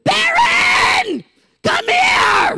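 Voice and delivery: high, loud shrill call from another room. Retold or imitated. Voice interrupting a nap, shrill high shouted call. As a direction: call him like a small shrill voice summoning. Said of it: "a still, shrill, small voice go".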